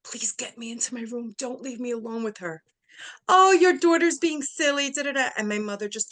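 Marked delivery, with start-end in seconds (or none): 0.0-2.6 hushed, half-whispered begging; dry-mouthed plea. Retold or imitated. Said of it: "telling my mother with my dry mouth". 3.3-5.3 high, loud, sing-song dismissal; mock the nurse's sugary brush-off; fake sweetness.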